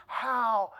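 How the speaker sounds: slow, soft reproach, falling low at the end; hushed, stern rebuke with a pause after "how"; God's rebuke. Retold or imitated. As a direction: quietly drop the words like a verdict. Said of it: "That's how he said it".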